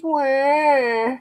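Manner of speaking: drawn-out mock baby wail; mock crying. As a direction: wail like a fake crybaby. Mocking offended people as crybabies.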